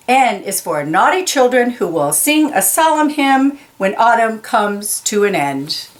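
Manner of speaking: plain reading voice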